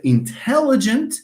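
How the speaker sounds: "intelligent" stressed on TEL. Shown as a demonstration. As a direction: punch the second syllable of "intelligent". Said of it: "playing with the pitch".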